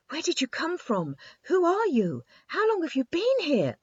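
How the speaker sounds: high, eager, tumbling questions; breathless excitement. A narrator's reading. The lonely boy king meets the girl, breathless and excited. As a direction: blurt it out, thrilled at last. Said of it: "asked the king breathlessly".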